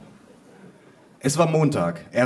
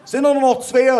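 high, loud far-across-the-room call; grandma shouting; 13 semitones higher, 8 dB louder than usual. A narrator's reading. Grandma from the other end of room, high shout. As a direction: holler from behind a wall of smoke. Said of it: "rief meine Oma irgendwo hinter einer dichten Nebelwand".

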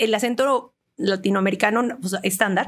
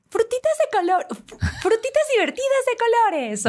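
much higher, bright cartoon-ad delivery; kids' cereal ad voice; 13 semitones higher than usual. Shown as a demonstration. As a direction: sell it to kids like a cereal commercial. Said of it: "¿Cómo dice, por ejemplo, el comercial?". (voz de comercial infantil, mucho más aguda)

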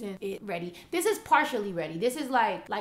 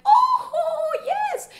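put-on very high, louder, thrilled old lady voice; eager old lady; 9 dB louder than usual. Lady accepting the offered dog, high thrilled squeal. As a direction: squeal it like she can't believe her luck. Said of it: "And the lady was like".

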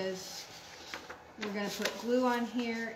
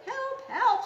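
high, louder storybook scream; 14 semitones higher, 8 dB louder than usual. A narrator's reading. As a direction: cry out for help, hammed up. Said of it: "screamed Dad".

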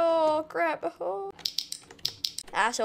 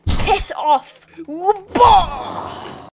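loud, raised whooping attack; battle cry; 5 semitones higher, 8 dB louder, much slower than usual. Pretend. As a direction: whoop as the blow swings. Striking enemies in game.

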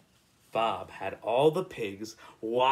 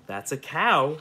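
plain reading voice

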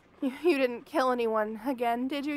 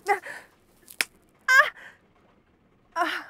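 startled pained cry; 6 semitones higher, 6 dB louder than usual. Acted. Slapped by captor.

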